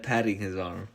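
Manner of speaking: ordinary delivery, nothing marked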